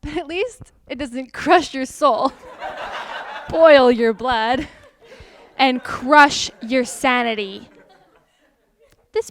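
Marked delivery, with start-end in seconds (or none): 0.0-7.7 loud words through laughter; laughing through. Acted. Vlogger laughing while listing a server's frustrations, loud. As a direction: laugh it off bitterly. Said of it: "Fake laughing is hard".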